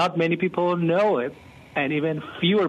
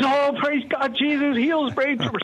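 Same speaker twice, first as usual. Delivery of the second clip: much higher, bursting with joy; excited praise; 12 semitones higher than usual. Retold or imitated. Pastor reacting on air to the caller's healing, excited and much higher. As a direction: exclaim it like you can't contain the thrill. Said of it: "and I being excited".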